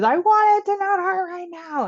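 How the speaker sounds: put-on high, drawn-out childish wail; mock crying scream. Mother imitating her hurt child, mock crying wail. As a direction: imitate a child wailing in pain. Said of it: "screaming and crying".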